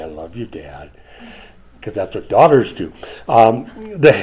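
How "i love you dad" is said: low, very soft, cozying-up murmur; sweet daughter. Retold or imitated. Daughter buttering up her dad, soft and sweet. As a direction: say it softly, leaning in to Dad. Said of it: "kind of lean up and say".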